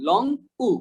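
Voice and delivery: "উ" held long after "long". Shown as a demonstration. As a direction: stretch "উ" as a long "oo". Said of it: "এটা হচ্ছে long".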